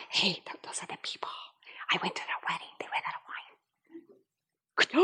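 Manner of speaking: gossiping guests whisper about the couple; gossipy whisper. Acted. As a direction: lean in and whisper the scandal.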